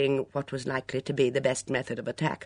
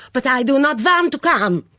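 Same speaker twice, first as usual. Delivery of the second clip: higher, louder panicked foreign shriek; screeched; 11 semitones higher, 10 dB louder than usual. Acted. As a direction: screech it in a foreign accent, backing away. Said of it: "screeched Mitzi".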